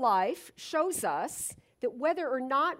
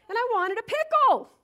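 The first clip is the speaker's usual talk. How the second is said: much higher and louder, insisting on the want; emphatic craving; 11 semitones higher, 8 dB louder, faster than usual. Real, not acted. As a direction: blurt out the craving. Craving a pickle, can't open jar.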